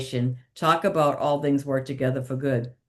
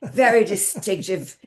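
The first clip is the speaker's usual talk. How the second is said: Joan Rivers voice held; 3 semitones higher than usual. Retold or imitated. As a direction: still in a light Joan Rivers voice, a bit higher. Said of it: "Joan has got one of the very distinctive voice".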